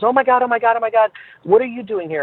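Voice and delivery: retelling a fan's high, excited squeal at the airport; excited fan gushing. Retold or imitated. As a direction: gush like a starstruck fan spotting a star. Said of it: "this woman just started to scream and went crazy".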